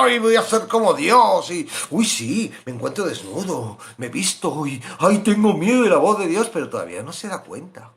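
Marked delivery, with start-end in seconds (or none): 0.0-6.5 naive Adam. Acted. Voicing Adam's naive thoughts.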